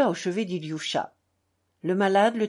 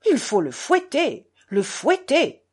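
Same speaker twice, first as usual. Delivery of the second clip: raised, indignant fury; furious; 6 semitones higher, 5 dB louder than usual. A narrator's reading. Doctor demands the boy be whipped, furious. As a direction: stamp out the demand, high and angry. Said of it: "dit le docteur furieux et trépignant".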